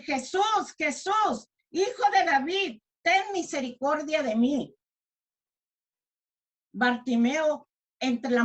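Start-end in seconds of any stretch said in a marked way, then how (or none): 0.0-4.7 acted high-pitched call for mercy; crying out. Preacher reading Bartimeo's plea to Jesús, acted crying out. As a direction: call out as a pleading blind beggar, moderately loud. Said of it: "comenzó a dar voces y a decir".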